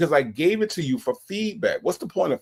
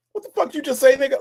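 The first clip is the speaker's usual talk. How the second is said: mock outraged demand; 11 semitones higher, faster than usual. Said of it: "why the hell she ain't say".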